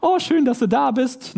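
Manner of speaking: high, slow, overly sweet mock greeting; a hint of fake, gushing friendliness; sugary niceness. Preacher mocks shallow niceness with a sweet, slow, high greeting. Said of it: "mehr als dieses".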